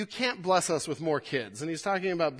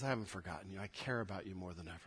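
hushed and much softer; tender; 9 semitones lower, 13 dB quieter, much faster than usual. Acted. Pastor voices God comforting his people, hushed. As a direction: speak it close and tender. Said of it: "And God says".

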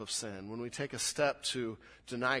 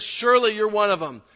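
loud, high, certain accusation; sure, insistent accusation; 7 semitones higher, 13 dB louder than usual. Acted. Accuser telling Peter he is one of them, insistent. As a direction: accuse him with total certainty. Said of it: "much more sure of himself".

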